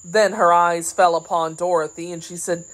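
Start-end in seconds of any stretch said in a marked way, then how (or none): none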